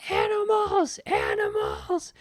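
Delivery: high, stretched-out mock yell; drawn-out hammed-up shriek. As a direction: shriek it down the street like a hooker in a panic. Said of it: "ran out in the street yelling".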